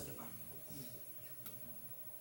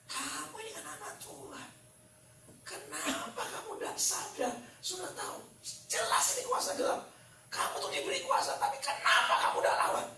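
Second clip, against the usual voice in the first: higher, rising to loud, half-laughing reproach; retold rebuke that climbs to a mock shout; exasperated scolding; 11 semitones higher, 6 dB louder than usual. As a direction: scold her, building up. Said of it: "Saya langsung marah sama gurunya".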